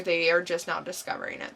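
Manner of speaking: normal talking voice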